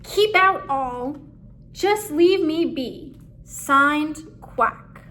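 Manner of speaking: loud, keep-away warning; grumpy duck posting a keep-out sign, loud. A narrator's reading. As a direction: bark it out like a grumpy hermit's sign. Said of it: "A nearby squall read".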